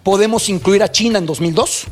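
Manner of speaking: ordinary talk, nothing marked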